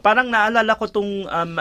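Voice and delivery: normal talking voice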